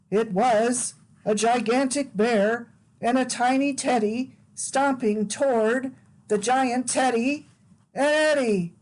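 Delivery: put-on lively read-aloud for small children; animated picture-book narration; storybook reading. A narrator's reading. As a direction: read it like bedtime story time.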